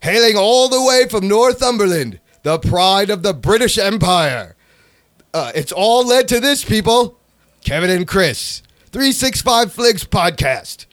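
grand announcer, high and drawn out; hammed-up promo, last word held very long; mock promo announcer. Shown as a demonstration. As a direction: announce them like champions entering the arena. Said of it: "You can use this as your promo".

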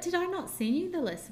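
high-pitched puzzled question; surprised midwife. Retold or imitated. Midwife realising she forgot the list, surprised. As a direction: imitate the midwife's dawning surprise.

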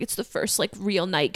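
soft, subdued self-pity; retold sadness. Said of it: "I was, like, so sad".

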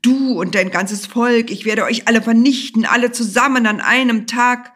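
yelled in mounting fury; furious shouting. A narrator's reading. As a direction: shout it out, hammering every word. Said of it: "schrie er".

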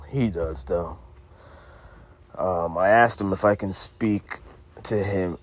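low, soft, hesitant explaining; subdued straight-man voice. Acted. Annie telling listeners about Manny's cartoon project, subdued straight-man voice. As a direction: explain it quietly and low, with halting pauses.

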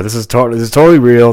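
loud, insistent push; emphatic. Real, not acted. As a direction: insist hard that it's true. Insists the story is real.

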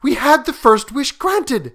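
high, loud, agitated insistence; frantic old woman, pitched high; feverish. A narrator's reading. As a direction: argue it feverishly. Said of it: "said the old woman feverishly".

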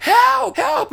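high imitated yell out a window; mock high cry for help. Girl at the window waving to a car, mock high yell. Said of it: "she's yelling out the window".

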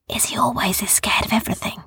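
unvoiced whisper; hushed aside to the boys. A narrator's reading. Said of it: "whispered Kester".

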